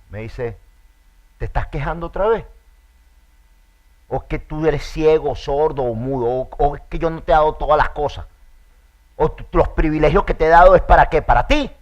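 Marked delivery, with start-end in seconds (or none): 1.4-11.7 much louder and higher stern rebuke; authoritative scolding. Acted. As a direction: scold a complaining servant with full authority. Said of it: "sino con autoridad". (Dios regañando con autoridad, a gritos)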